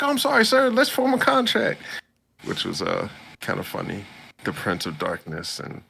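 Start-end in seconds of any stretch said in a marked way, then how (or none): 0.0-1.8 mock-frightened, much higher and louder, falling over himself to apologize; scared high squeak. Demon prince to the hero he fears, a hammed-up frightened squeak. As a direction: grovel in a squeaky panicked voice. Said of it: "he was scared".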